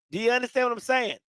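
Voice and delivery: normal talking voice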